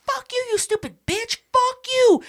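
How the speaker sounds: very high, mock-furious yelling; shrieking insults. Two women fight in locker room, high angry yelling.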